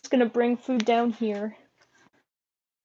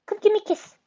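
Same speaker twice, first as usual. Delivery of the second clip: higher sweet coaxing voice; pet talk; 10 semitones higher, 3 dB louder, faster than usual. Real, not acted. Coaxing the pet bird for a kiss. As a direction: coax the bird up high.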